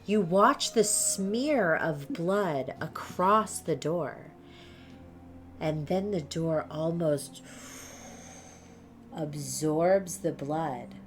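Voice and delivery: slow, hushed, spooky game master telling; eerie scene narration. A narrator's reading. Game master narrating the glowing door to players, hushed and slow.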